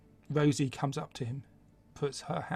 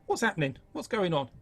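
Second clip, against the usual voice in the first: higher, louder and urgent; alarmed; 4 semitones higher, 6 dB louder than usual. Acted. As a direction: panicky questions to the party. Son asks party, alarmed.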